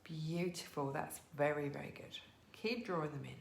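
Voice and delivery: soft, calm yoga instruction; guided yoga cue. Acted.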